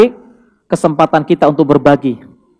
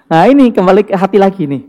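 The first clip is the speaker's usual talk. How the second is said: ordinary talk, nothing marked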